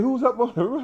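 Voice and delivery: very high, baffled mock question; incredulous. To the nurse looking skyward, high incredulous joke. Retold asking nurse about stages.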